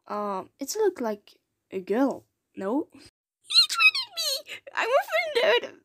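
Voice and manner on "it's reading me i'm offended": a pinched little creature, deeply offended; squeaky character. Acted. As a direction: stretch it out in a tiny cartoon squeak. Retake of whiny character line.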